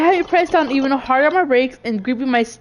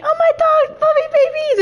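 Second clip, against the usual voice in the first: much higher, squealing mock scream; imitated excited scream; 14 semitones higher than usual. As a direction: squeal it like the friend does when she spots puppies. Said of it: "we hear Noodle screaming".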